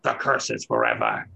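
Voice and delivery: low, gurgling monster voice, mocking; deep gurgling death rattle taunt; mocking dying monster. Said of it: "mockingly laugh a little bit under his bleeding, gurgling breath".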